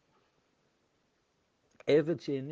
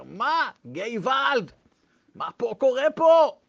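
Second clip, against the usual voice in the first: higher, louder "gevald" outcry; alarmed Yiddish father; 8 semitones higher, 5 dB louder, slower than usual. Retold or imitated. The father reacts to the odd prayer, shocked and loud. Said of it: "אבא שלי אומר".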